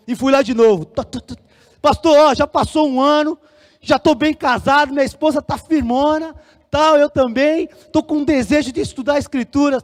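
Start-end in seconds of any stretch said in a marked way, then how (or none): 1.8-9.8 higher, insistent pitch to the pastor; retold eager plea. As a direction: re-enact pleading your case eagerly. Volta a pedir ao pastor. (pedido animado e insistente, reencenado)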